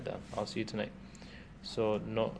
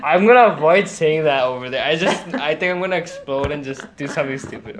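after laughing hard; 4 semitones higher, 8 dB louder than usual. Real, not acted. Winding down from laughter.

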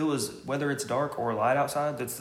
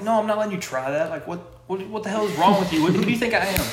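loud, high, incredulous protest; mock outrage at a crazy request; raised voice, sputtering objection; indignant refusal; 7 semitones higher, 6 dB louder than usual. Acts out indignant refusal to aunt.

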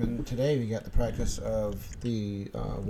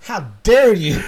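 loud, drawn-out, raised indignation; mock outrage; 5 semitones higher, 11 dB louder, slower than usual. Host acts offended at the co-host's joke, mock outrage. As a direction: play hugely offended, slow and loud.

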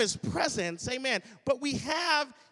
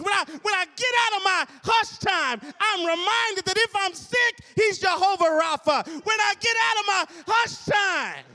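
louder, higher shouted build; preaching at full force; 6 semitones higher, 6 dB louder than usual. Acted. Preacher exhorting about hush time, full-force shout. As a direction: rise to a climax.

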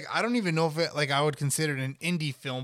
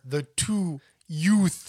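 slow and louder, Brooklyn yoots; New York lawyer accent; 3 semitones higher, 6 dB louder, much slower than usual. Retold or imitated. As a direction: drawl it like Vinny in court. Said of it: "I'm sorry, Your Honor".

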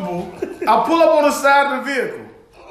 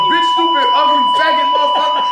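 raised, punchy string of abuse; spewing insults; 4 semitones higher, 4 dB louder than usual. Retold or imitated. Ranting at the other car, angry. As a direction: fire off each insult high and forceful. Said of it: "spewing out a bunch of words".

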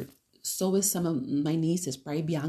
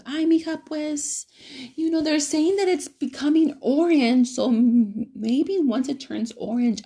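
higher, louder mock mom; mom's voice; 8 semitones higher, 4 dB louder than usual. Mom explains to her daughter why she won't visit yet, higher and louder. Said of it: "And she goes".

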